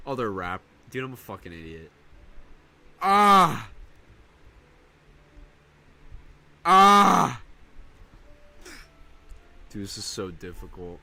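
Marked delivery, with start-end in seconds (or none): 3.0-7.4 much louder, higher ah shouts; straining all-out yells; loud yelling. Real, not acted. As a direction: yell harder to go higher. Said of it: "do I have to yell louder to get higher".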